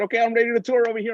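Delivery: fast, high-pitched readiness; acted keen volunteer; eager announcement. Acts out his eager announcement.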